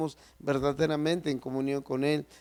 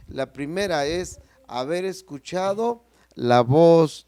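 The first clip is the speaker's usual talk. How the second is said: preaching with force; 5 dB louder than usual. Acted. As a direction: proclaim it with rising force to the congregation. Preacher raises his voice.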